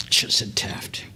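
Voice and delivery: breathy self-scolding whisper under the breath; whispered aside. Real, not acted. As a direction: whisper it to yourself, annoyed at the slip. Muttered aside to himself.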